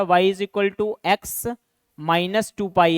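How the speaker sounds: each word of the equation spelled out slowly; dictating slowly so the class can write it down; slow dictation. Shown as a demonstration. Teacher dictating to students, slow and deliberate.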